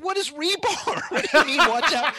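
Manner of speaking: panicked disbelief. Retold or imitated. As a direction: squeak it out, half-laughing and lost. Panicked confusion on the ladder.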